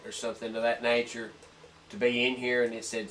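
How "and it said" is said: ordinary talk, nothing marked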